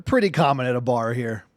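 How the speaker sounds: ordinary delivery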